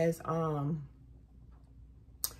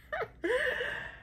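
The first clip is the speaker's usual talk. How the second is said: squealing happy laugh; a high, giddy laugh; high giggle; 3 dB quieter than usual. Real, not acted. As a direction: laugh in delighted disbelief. Laughs after being recognized.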